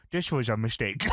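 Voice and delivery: lightly voiced, low regret; a hint of the hurt commentator. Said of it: "And J R says".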